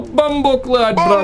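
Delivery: put-on playful Jamaican accent, pitched high. Reactor showing off a favourite accent, playful Jamaican accent. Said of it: "the Jamaican accent as well".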